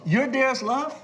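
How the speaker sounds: high-pitched mock astonishment; incredulous. Executive meets the kid who faxed him, incredulous. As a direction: act out disbelief with a rising question.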